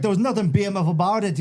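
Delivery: put-on high, cocky Irish accent; Conor McGregor. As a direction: taunt in a put-on Irish brogue. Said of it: "He's like".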